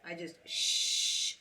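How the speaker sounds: slow, plain American shh. Shown as a demonstration. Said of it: "exhale with an American accent".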